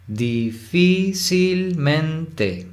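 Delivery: "Difícilmente" very slowly, each syllable split off. Shown as a demonstration. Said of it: "primero identificamos la sílaba tónica". ("Difícilmente" separado en sílabas, muy lento)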